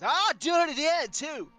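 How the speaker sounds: high, loud bratty jeer; taunting kid. Acted. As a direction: jeer at them like a street kid. GM voices grinning kid taunting.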